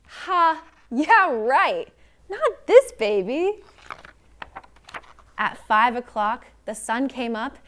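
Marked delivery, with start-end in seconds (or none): 0.1-3.6 loud, high sarcastic exclamation; mocking scoff. Narrator undercutting the idea Baby stayed asleep, scoffing. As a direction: scoff loudly in swooping disbelief.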